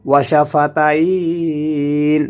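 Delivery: শাফাতাইন held three alifs at the end; long madd. Shown as a demonstration. Teacher showing the long end of শাফাতাইন. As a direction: stretch the তাই in শাফাতাইন. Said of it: "এখানে তিন আলিফ টেনে পড়তে হবে".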